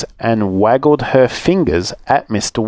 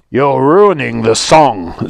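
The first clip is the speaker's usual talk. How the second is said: slow, stern wolf warning; slower than usual. Acted. Mister Wolf scolding Little Rude, slow and stern. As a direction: lay down the law slowly. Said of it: "said Mister Wolf sternly".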